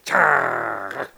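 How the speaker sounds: harsh spat-out hiss; spitting cat hiss. A narrator's reading. Unseen cat behind Buster, spitting cat hiss. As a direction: imitate a cat spitting a warning. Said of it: "followed by a spitting".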